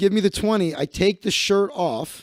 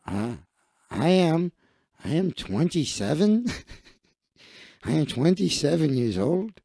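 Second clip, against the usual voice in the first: put-on Mexican accent. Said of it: "I'm losing my Mexican voice".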